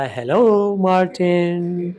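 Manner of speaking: very drawn-out, sing-song spooky hello; menacing lady's greeting. Acted. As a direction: stretch each word out eerily as the scary lady. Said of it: "lady ने बोला". (डरावनी औरत का खींचा हुआ hello)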